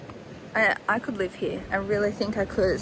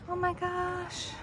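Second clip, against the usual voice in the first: awed gasp; 9 semitones higher, 8 dB quieter, slower than usual. Real, not acted. Awed at the view.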